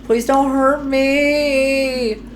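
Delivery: high, slow, pleading voice; frightened plea. Acted. Voicing frightened game character.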